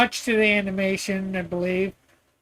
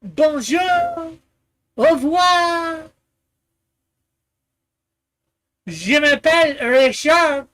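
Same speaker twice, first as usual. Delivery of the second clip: fake French accent; 2 semitones higher than usual. Showing off a few French words, hammed-up French accent. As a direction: play a pretend Frenchman, pitched up. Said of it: "I could put on, eh, the accent".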